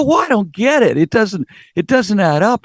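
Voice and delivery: high, puzzled protest; mild baffled reply. Retold or imitated. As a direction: push back in disbelief. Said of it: "I go".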